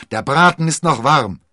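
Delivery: loud, high, delighted invitation; beaming. A narrator's reading. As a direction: call it out happily to welcome guests. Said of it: "strahlte er".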